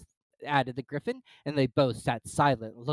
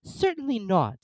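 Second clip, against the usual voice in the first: indignant; 10 semitones higher, 3 dB louder, slower than usual. Acted. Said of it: "said Alice indignantly".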